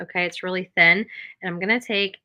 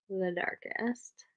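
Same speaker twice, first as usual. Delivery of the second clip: whisper; 10 dB quieter, much slower than usual. Real, not acted. Tip about first brush touch.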